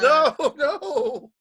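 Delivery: high, loud drawn-out objection; playful protest. Pretend. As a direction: wail a mock protest at a stubborn mistake. Mock protest at repeated mispronunciation.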